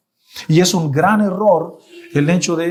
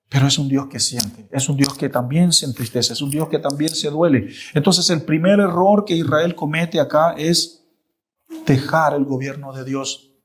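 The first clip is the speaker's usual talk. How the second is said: ordinary delivery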